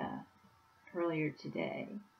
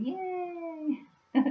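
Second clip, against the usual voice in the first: excited cheer; 11 semitones higher than usual. Real, not acted. Celebrating the hippogriff's new home.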